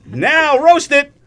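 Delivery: drawn-out lead-in, then a loud punch; announcer kickoff. Real, not acted. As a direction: call the start of a battle. Host kicks off the roast battle.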